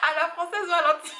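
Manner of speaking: high, loud laughing words. Real, not acted. Laughing at her own French pronunciation, laughing. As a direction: laugh through it, pitched well up.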